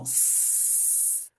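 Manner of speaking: very long, soft hissed "S"; sustained voiceless hiss on "S"; prolonged S. Shown as a demonstration. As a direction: stretch "S" into an unbroken sss. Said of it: "como que você pode prolongar o som".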